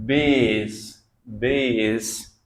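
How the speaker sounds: "बेस" drawn out very slowly as base, the a gliding into i; slow modelling of a word. Shown as a demonstration. Teacher slowly modelling a word for students. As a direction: stretch "बेस" so every sound is heard. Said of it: "तो इसको क्या बोलेंगे?".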